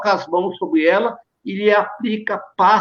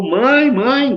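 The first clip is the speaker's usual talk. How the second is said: higher, restrained cry for mother; mild cry out; 5 semitones higher, 4 dB louder than usual. Acted. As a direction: read it as a mildly raised plea. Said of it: "gritava de joelhos". (grito contido de súplica)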